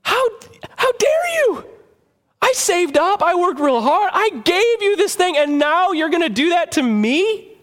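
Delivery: high, outraged how dare you; offended friend scolding the ungrateful; indignant giver. Retold or imitated. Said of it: "you recognize".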